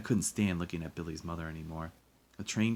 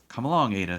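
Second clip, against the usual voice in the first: loud, raised, curt command; snapping; 5 semitones higher, 9 dB louder than usual. A narrator's reading. Woman hauling boy away calls the girl, snapping. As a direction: snap it out impatiently. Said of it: "she snapped without looking back".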